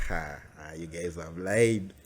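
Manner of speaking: usual speaking voice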